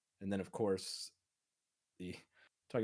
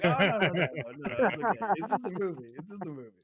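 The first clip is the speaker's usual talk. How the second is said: high, loud retold backpedalling through laughter; laughing reassurance; 9 semitones higher, 12 dB louder, faster than usual. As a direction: hurriedly take it back, cracking up.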